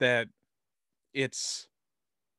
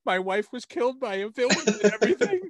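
mock Gordon; 8 semitones higher, 5 dB louder than usual. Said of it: "have Gordon be like".

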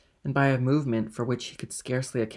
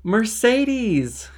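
high, loud, happy call; ringing shout of a sweetheart's name; joyous call; 11 semitones higher, 9 dB louder than usual. A narrator's reading. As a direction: call out joyfully from outside. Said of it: "cried a voice joyously outside the house".

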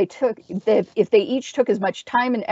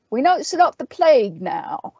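dry, higher-pitched character voice; dry remark; 6 semitones higher than usual. A narrator's reading. Owl to his companions after the corpse falls, dry. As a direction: observe it drily, pitched up. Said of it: "he observed dryly within his helmet".

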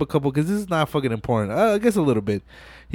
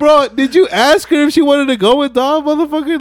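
incredulous outburst; 11 semitones higher, 6 dB louder than usual. Real, not acted. Speaker mocking Leon.

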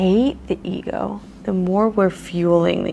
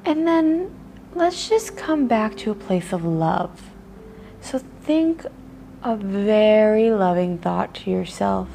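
guided meditation; 4 dB quieter than usual. Acted. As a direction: ease the listener into noticing their body. Leads a body-scan meditation.

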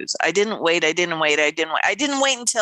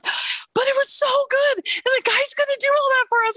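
excited. Retold or imitated. Roxanne's excited turnaround retold.

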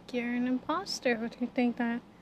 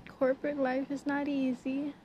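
normal talking voice

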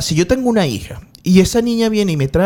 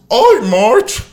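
Homer Simpson; 3 semitones higher than usual. Retold or imitated. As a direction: call out to Marge like Homer. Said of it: "¿Cómo sería la voz de Homero Simpson?".

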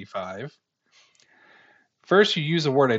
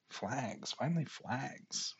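puzzled repeat; 15 dB quieter, slower than usual. Real, not acted. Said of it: "What does that mean?".

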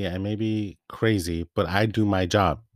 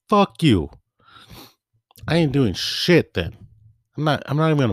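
real angry venting; 6 semitones higher, 4 dB louder than usual. Real, not acted. Ranting about the supervisor to listeners, defiant anger. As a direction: bite off each word in anger.

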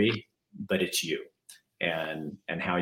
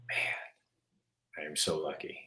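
near-whisper, much softer than usual; hushed private thought; 2 semitones lower, 9 dB quieter, much slower than usual. Retold or imitated. Host imagining a parent looking in on sleeping kids, hushed. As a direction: murmur it to yourself in a hushed voice.

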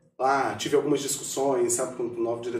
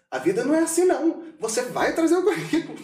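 much higher, pushy mock lecture; Ju insisting; 12 semitones higher than usual. Imitating Ju lecturing him to send the CV, insistent. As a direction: push him like a bossy mentor.

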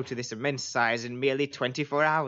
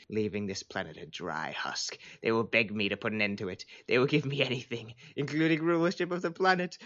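usual speaking voice

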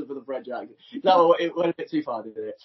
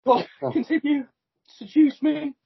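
higher-pitched French seductress; Melanie; 6 semitones higher than usual. Acted. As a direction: urge a suitor on in a mock French accent. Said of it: "Should I be Melanie?".

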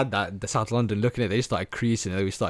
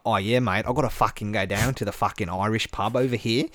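imitated Aussie bloke; hammed-up Australian pub-goer; Australian accent; 4 dB louder than usual. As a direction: do a matey, sweary Australian. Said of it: "everything you expect from an Aussie".

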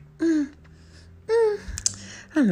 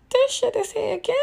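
high squeaky disbelief, much higher; shocked disbelief; 13 semitones higher than usual. Retold or imitated. Podcaster reacting to a post, high shocked squeak. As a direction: gasp it out like you can't believe it.

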